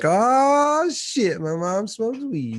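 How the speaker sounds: high, loud shocked squeal. Retold or imitated. Teen discovering his mom smokes weed, high shocked squeal. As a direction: yelp it up high in disbelief. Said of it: "I'm like".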